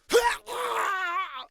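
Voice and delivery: very high, loud drawn-out scream; attack scream. Acted. Crew as the cockpit is attacked, screaming.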